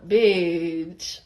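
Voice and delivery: low, drawn-out, offhand "bitch"; casual "bitch". Shown as a demonstration. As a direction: drop it low and long, no big deal. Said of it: "It was like a".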